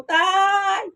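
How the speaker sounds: high call to father. Retold or imitated. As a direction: shout Tay across the yard. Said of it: "at sumisigaw".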